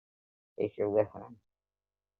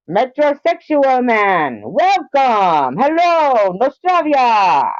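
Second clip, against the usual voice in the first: much higher, louder, slow welcome; announcer voice; 11 semitones higher, 5 dB louder, much slower than usual. Acted. Streamer welcoming chat in a showman voice. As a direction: boom it out like a showman.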